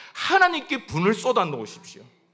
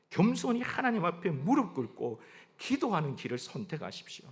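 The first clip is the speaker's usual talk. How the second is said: ordinary talk, nothing marked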